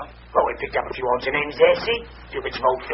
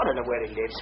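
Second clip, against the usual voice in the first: usual speaking voice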